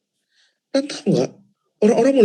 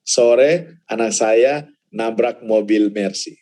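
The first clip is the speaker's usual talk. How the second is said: ordinary talk, nothing marked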